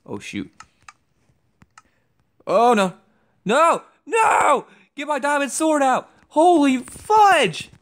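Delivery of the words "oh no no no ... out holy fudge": high, loud panicked shouts; panicked yelling. Real, not acted. Gamer in a losing fight, high panicked yelling. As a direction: yell in panic as the fight turns.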